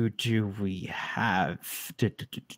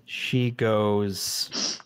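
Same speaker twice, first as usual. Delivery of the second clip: ordinary talk, nothing marked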